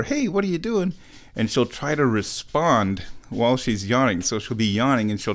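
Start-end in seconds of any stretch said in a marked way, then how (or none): 0.0-0.9 put-on high baby-talk question; high, cooing pet voice; pet talk to the cat. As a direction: ask it the way you'd coo at a kitten. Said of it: "I'll say something like".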